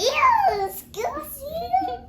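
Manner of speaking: high, loud, disgusted. Real, not acted. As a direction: recoil at the rude noise. Reacting to a burp.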